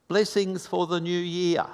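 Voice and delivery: high-pitched Italian greeting; lilting mock-Italian accent, pitched high. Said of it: "with an Italian accent".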